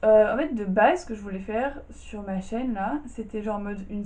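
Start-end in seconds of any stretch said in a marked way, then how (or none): none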